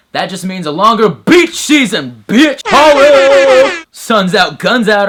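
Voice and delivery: loud, high gym-bro hype; hammed-up shouting bro. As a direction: yell it like a pumped-up meathead showing off. Bro pumping himself up.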